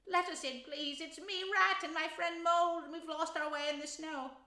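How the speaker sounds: high-pitched, urgent pleading; small animal's high plea at the door; crying out. A narrator's reading. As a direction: beg to be let in, voice pitched right up. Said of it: "cried the rat".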